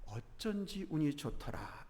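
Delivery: slow, hushed rueful lament. A narrator's reading. Widowed rickshaw puller musing on his luck, lamenting. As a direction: say it slowly and softly to yourself. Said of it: "김첨지의 넋두리로".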